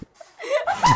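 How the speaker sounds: squealing laugh; high burst of laughter. Real, not acted. Embarrassed mic mishap.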